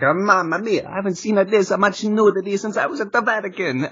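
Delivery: high hammed-up Italian accent. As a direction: ham up a cartoon Italian. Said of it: "He's like".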